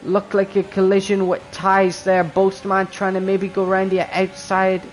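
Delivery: excited, high play-by-play; motorsport commentator. Acted. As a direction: call the race start live. Live race start play-by-play.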